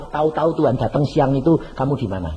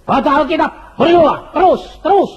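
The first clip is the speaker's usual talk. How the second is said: high, loud, driving the words home; urgent preaching shout; 8 semitones higher, 3 dB louder than usual. Real, not acted. Preacher pressing the crowd to keep praying, shouting. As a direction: hammer it at the congregation.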